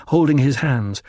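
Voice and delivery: ordinary delivery, nothing marked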